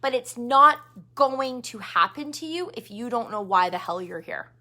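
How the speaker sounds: ordinary delivery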